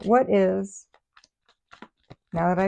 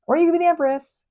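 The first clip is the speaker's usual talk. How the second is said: excited pitch; 9 semitones higher, 4 dB louder, faster than usual. Acted. Contrasting with the sad option.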